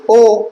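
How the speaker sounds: long ओ with lips rounded; rounded o vowel. Shown as a demonstration. Teacher demonstrating rounded ओ. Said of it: "ओ बोलो".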